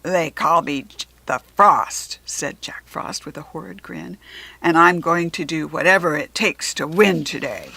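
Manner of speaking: low, loud sneering villain. Acted. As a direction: growl it with a nasty grin. Jack Frost villain voice.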